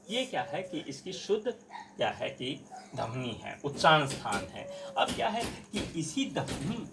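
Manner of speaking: usual speaking voice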